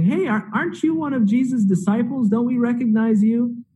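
questioning bystander. Retold or imitated. Bystanders question Peter in the courtyard.

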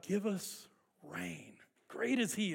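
soft, slow pleading; hushed prayer. Retold or imitated. Preacher voicing the praying crowd, hushed and slow. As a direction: pray it softly, slowly.

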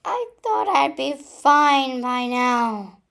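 loud slow frustrated yell; yelling into pillow. Acted. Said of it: "I yelled into my pillow".

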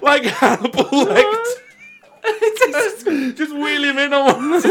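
squeaky high through laughter; laughing. Real, not acted. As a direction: push the words out through giggles. Laughing about Hannibal Lecter.

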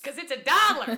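loud, high gleeful shout. Pretend. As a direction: yell the catchphrase with glee. Shouting husband's catchphrase.

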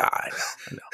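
hushed, unvoiced mock-emotional reply. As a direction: whisper it, as if barely holding together. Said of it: "He gets a little emotional".

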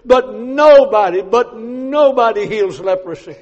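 preacher driving home that nobody heals leprosy; emphatic declaration. Real, not acted. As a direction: hammer the word nobody.

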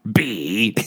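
mildly mock-angry toy voice. Co-host repeating the toy's B for laughs, mock angry. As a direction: imitate a toy blurting a cross letter. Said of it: "it sounded angry".

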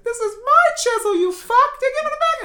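put-on high kid voice. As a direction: snap back like a bratty kid. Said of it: "And he's like".

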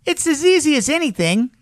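boastful rabbit, pitched very high; breezy high-voiced show-off; furry rabbit. A narrator's reading. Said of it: "said the furry rabbit".

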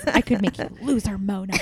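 deadpan serious line read. Retold or imitated. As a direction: say it dead seriously. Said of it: "really serious tone".

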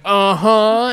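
high mocking uh-huh; one-up them with a smug uh-huh; tough guard grunt. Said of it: "And he's like".

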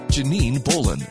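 ordinary talk

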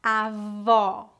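avó with open O, /ɔ/ like "aw"; stressed open ó at the end of avó. Shown as a demonstration. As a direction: say avó with the mouth wide open on the last vowel. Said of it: "Repita depois de mim".

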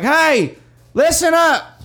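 high, loud call to a crowd; hammed-up rally yell; mock podium shout. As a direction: holler for attention as a pretend speaker. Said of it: "me going up at a podium".

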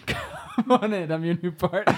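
laughing imitation. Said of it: "it's a line from Lethal Weapon four".

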